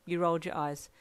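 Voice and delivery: ordinary delivery, nothing marked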